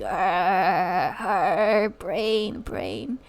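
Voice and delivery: low moaning zombie voice; zombie groan. Retold or imitated. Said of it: "I'm like".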